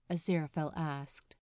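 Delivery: plain reading voice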